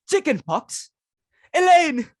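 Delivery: high, loud cry of Elaine; alarmed call. Acted. Mother cries out to daughter Elaine in alarm. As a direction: shriek her name in alarm.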